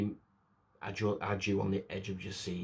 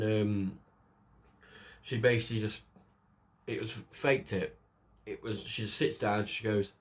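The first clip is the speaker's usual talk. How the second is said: ordinary delivery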